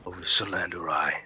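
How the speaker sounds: low, hushed Fey Lord, long pauses. Acted. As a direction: declare your rule softly and low, word by word. Game master voices Fey Lord.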